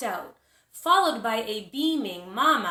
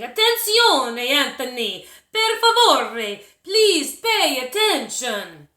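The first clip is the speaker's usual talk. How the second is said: loud, slow, exasperated plea; scolding teacher; 3 semitones higher, 9 dB louder, slower than usual. A narrator's reading. Teacher pleads with dreamy Anthony, loud slow exasperated scolding. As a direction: stretch each word to make him listen.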